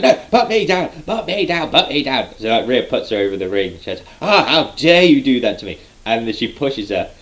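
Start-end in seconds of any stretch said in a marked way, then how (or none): 0.0-2.3 high-pitched imitation of a wrestler being lifted, easing down; mock panicked protest. 4.2-5.8 mock indignation. Indignant wrestler imitated.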